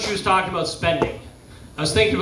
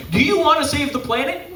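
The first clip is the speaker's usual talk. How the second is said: lightly acted, higher-pitched prompt; acted question; 7 semitones higher than usual. Self-checkout asking a billionaire, lightly voiced higher question.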